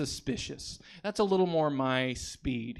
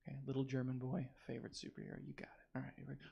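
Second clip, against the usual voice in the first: muttering; 14 dB quieter, much faster than usual. Real, not acted. Muttered setup.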